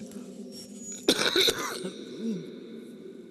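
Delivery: rough wheezy cough; hoarse cough. Real, not acted. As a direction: cough hard into the mic. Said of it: "I'm really not in good shape".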